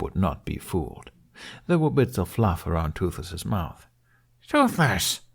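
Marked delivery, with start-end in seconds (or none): 4.5-5.2 sharp, higher scolding snap; snapped. Acted. Hiccup scolding the dragon over eaten sheep, sharp snap. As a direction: bite off the name in annoyance. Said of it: "he snapped".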